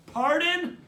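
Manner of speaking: high, loud query; yelled question. A narrator's reading. Brother feigning deafness to annoy his sister, yelling. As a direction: shout it as if unable to hear. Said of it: "I yell".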